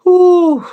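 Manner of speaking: high, loud exasperated "ooh"; exasperated whoop. Real, not acted. Streamer fed up with fall lovers, high exasperated ooh. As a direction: let out a sharp, high groan of irritation.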